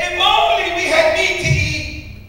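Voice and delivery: high, slow whiny complaint; whining Israelites. Acted. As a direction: whine it out like a spoiled crowd. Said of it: "started wailing".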